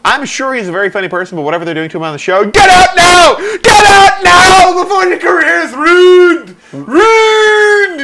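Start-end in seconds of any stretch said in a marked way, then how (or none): 2.4-8.0 high, frantic yelling plea; desperate shout. Pretend. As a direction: scream a warning, near tears. Frantic warning to the comedian.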